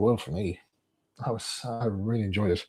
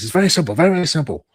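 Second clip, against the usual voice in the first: impatient boss; 6 semitones higher, 7 dB louder, faster than usual. Retold or imitated. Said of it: "And he says".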